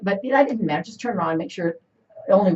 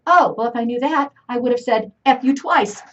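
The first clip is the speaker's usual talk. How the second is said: pitched-up sassy retort, louder; mom's sassy reply; 6 semitones higher, 6 dB louder than usual. Retold or imitated. Mom telling her daughter she'd swear twice, sassy. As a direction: toss it off with mock pride. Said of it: "And she says".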